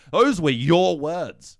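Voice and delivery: slow, loud stern pronouncement; booming judge. Acted.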